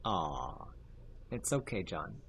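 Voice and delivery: soft, hushed pity for a gamer; mock consoling. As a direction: coo it softly like comforting a kid. Consoling John who is losing games.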